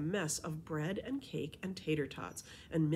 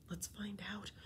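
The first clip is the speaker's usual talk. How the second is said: voiceless, softer whisper back; 3 semitones higher, 9 dB quieter than usual. Acted. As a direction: hush it bravely. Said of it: "I whispered back".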